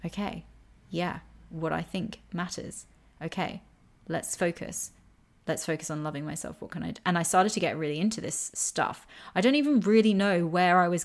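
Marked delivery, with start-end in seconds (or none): 0.1-7.0 hushed, retold inner voice, much softer; quiet self-talk. Speaker recalling her thoughts once she had space and peace, quiet self-talk. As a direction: think it through softly to yourself.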